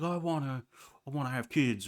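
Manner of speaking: high Texas twang on a mock outburst; Texas accent. Host mimicking someone raising their voice at a partner, Texas accent. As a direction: put on an exaggerated Texas accent. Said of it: "Why did I do a Texas accent?".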